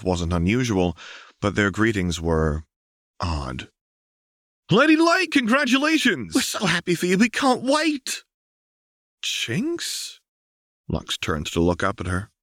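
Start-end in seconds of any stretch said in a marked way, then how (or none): 4.7-8.2 much higher and louder, excited greeting; cheering townsfolk. A narrator's reading. 9.2-10.2 puzzled question. A narrator's reading. Lux puzzled.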